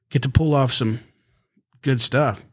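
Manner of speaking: ordinary talk, nothing marked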